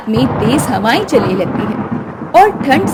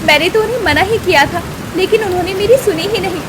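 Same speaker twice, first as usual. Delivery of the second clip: fast, raised excuse; hasty excuse; 5 semitones higher, faster than usual. Acted. Wife defending herself to husband, hasty. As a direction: rush the excuse out.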